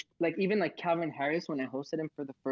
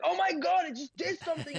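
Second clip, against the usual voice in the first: much higher, thrilled and breathless; excited squeal. Retold or imitated. Host reliving buying his first NFT, high excited squeal. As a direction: squeal it like the purchase just went through. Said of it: "I was so excited and happy".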